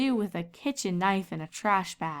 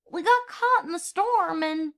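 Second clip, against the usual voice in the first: put-on high, apologetic young filly voice; sorry little sister, much higher; little filly Apple Bloom; 10 semitones higher than usual. A narrator's reading.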